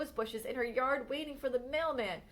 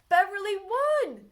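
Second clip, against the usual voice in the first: excited; 8 semitones higher, 7 dB louder, slower than usual. A narrator's reading. Narrator exclaims contest win.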